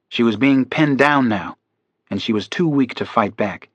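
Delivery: ordinary delivery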